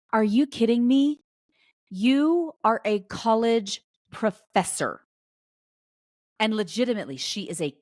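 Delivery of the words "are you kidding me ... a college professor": louder, higher, slow disbelief; are you serious, slowly and loud; replaying her stunned reply to the friend; incredulous disbelief.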